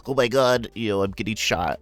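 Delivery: hammed-up cry in the middle of a game; mock gamer voice. Streamer imitating himself live for new viewers, mock gamer voice. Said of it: "they tune in to me going".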